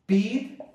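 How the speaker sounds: "pido" with a high, stretched PI, pause, then DO; stress on the root. Shown as a demonstration. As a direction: split "pido", pushing the PI up high. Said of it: "la fuerza de voz está cayendo aquí". ("pido" separado, con la fuerza de voz en PI)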